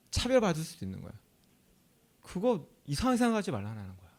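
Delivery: ordinary delivery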